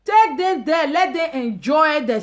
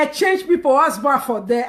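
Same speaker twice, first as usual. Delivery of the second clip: ordinary talk, nothing marked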